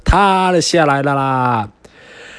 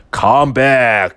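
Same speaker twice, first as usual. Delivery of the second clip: roar. Acted. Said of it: "he roared".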